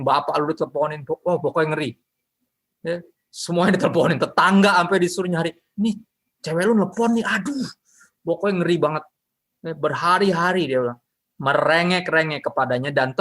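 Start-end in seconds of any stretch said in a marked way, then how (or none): 5.8-7.7 higher, lightly voiced fed-up neighbor; a hint of a pestered neighbor; annoyed neighbor. Neighbor telling Samson his girlfriend calls again, mildly exasperated.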